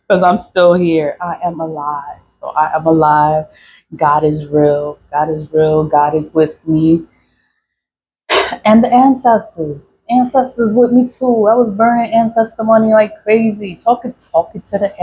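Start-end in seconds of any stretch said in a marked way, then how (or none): none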